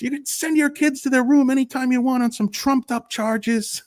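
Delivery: very high, sarcastic squeal; mocking retort. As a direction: squeak it out snidely. Continuing the mock rebuttal.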